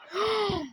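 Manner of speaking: high sharp gasp of delight; excited gasp. Real, not acted. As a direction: gasp in thrilled surprise. Discovering new furniture.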